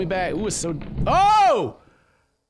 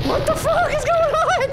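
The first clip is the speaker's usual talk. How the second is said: much higher, louder shocked yell; panicked gamer yell; startled outburst; 4 dB louder than usual. Real, not acted. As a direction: blurt it out in alarm. Under attack, confused.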